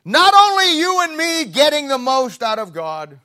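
rising to a loud, high preacher's cry; preaching at full force. Real, not acted. Preacher to congregation, loud high proclamation. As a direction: lift it high and hard to the congregation.

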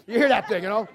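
normal talking voice

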